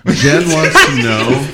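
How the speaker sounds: loud, high and slow; laughing announcer. Real, not acted. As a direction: announce it grandly. Host announcing a question.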